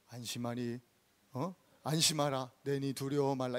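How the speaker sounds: low, soft, monotone scripture voice; overly dignified. Shown as a demonstration. Preacher showing how Jesus did not speak, dignified low murmur. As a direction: say it far too gently and solemnly. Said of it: "그렇게 점잖게 말씀하신 게 아니라니까요".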